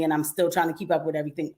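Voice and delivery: usual speaking voice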